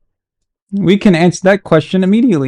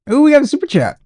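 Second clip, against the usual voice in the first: excited; 9 semitones higher, faster than usual. Real, not acted. Excited at super chat.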